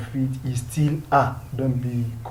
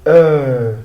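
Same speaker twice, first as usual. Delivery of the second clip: slow and loud, "e" repeated louder; slow loud drill; 8 dB louder, much slower than usual. Shown as a demonstration. Teacher slowly drilling the French "e". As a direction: stretch it out slowly for the class. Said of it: "is pronounced as e".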